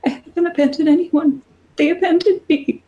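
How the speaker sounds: high, tearful goddess voice; a lost god pleading; weeping goddess. Acted. Goddess answering the warrior Sedna, tearful and high.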